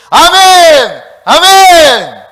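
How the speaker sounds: very loud, high, held cry; rousing long-drawn shout; demonstrated amen shout. Shown as a demonstration. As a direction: shout it full out to lead the congregation. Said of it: "한번 해볼까요?".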